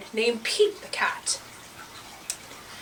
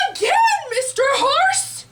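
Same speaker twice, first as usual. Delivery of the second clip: shouting; 4 dB louder than usual. Acted. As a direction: beg for another ride as a gang of animals. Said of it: "And they all shouted".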